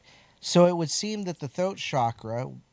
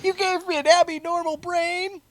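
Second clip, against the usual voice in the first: much higher, loud put-on comic voice; movie quote voice; 5 dB louder than usual. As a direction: squeak it out like a goofy film monster. Movie quote joke about jar.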